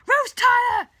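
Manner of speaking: very high, full-force yell; enraged bellow. A narrator's reading. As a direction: yell the name up to someone escaping. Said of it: "he yells above her".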